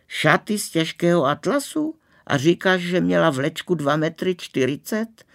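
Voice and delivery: high-pitched, marvelling echo; amazed. A narrator's reading. Girl hearing about the bride's train, amazed. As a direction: repeat the details in thrilled disbelief.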